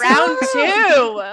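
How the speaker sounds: high loud round two call; hyped announcer. Acted.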